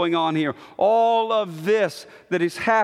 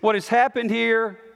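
usual speaking voice

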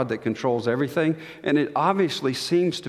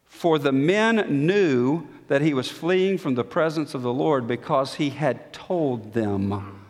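ordinary delivery

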